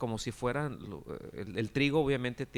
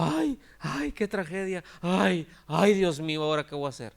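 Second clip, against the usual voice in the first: mock panic; 5 semitones higher, 7 dB louder than usual. Preacher shows what the owner never said, mock panic. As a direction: parody a landowner overcome by tragedy. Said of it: "no se asustó".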